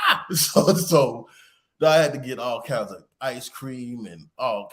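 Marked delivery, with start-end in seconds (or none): 0.1-0.3 imitated high child's wail; exaggerated crying "ah"; mock bawling wail. Daughter breaks down after dad's prank, mock wail.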